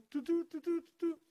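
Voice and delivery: high-pitched "doo doo" dialing beeps; sing-song electronic beeps by mouth; imitated phone tones.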